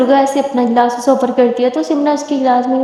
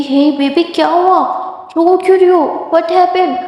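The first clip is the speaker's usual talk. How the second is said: higher, slow soothing words to a crying partner; gently comforting; 5 semitones higher, slower than usual. Acted. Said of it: "उसके आंसू पोंछते कहती".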